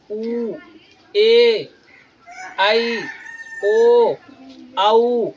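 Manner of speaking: उ, ए, आई, ओ, आउ each drawn out; held long vowels. Shown as a demonstration. Teacher listing long vowels, उ, ए, आई, ओ, आउ each held long. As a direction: hold each of उ, ए, आई, ओ, आउ separately, pauses between. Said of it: "अधिक समय लगता".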